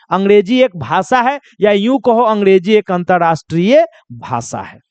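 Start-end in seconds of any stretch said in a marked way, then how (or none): none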